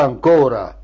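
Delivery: usual speaking voice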